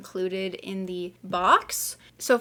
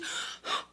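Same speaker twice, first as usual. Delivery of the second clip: gasp; 9 dB quieter than usual. Real, not acted. Unboxing, sees the box.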